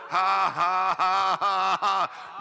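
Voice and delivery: loud, full demonstration laugh; laughing in faith. Shown as a demonstration. Preacher leading the church in a faith laugh. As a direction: laugh big so they follow. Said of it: "Glory to God".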